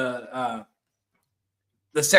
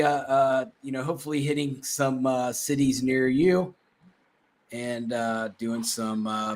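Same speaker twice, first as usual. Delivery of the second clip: ordinary talk, nothing marked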